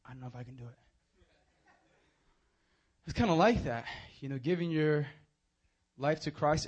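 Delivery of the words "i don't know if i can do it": much softer hesitant murmur; soft, small scared voice; timid doubt. Retold or imitated. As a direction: confess your fear under your breath. Scared kid backing off at poolside.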